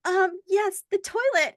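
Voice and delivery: high-pitched, mildly embarrassed reply; retold embarrassment. American newcomer sheepishly answering about the toilet, embarrassed. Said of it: "then I'm embarrassed".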